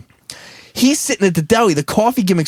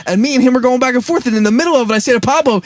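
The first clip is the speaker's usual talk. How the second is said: normal talking voice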